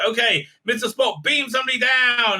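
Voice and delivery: mock captain shouting an order; urgent order. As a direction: bark the order up the chain.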